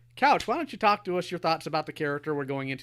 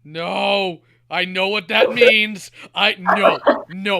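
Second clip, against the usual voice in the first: loud, higher scolding, like to a dog; scolding shout; 6 semitones higher, 12 dB louder, much slower than usual. Real, not acted. Scolding his co-host like a bad dog, loud. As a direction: scold him like a naughty pet.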